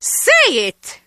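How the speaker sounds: sharp, loud command to confess; angry demand. Acted. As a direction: bark it as a furious order.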